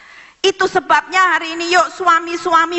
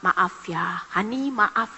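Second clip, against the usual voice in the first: soft, slow, lowered apologetic voice; gentle apology; 6 semitones lower, 6 dB quieter, slower than usual. Acted. Husband saying sorry to his wife, gently. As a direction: coax her softly into forgiving. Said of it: "Kalau sudah ngomong".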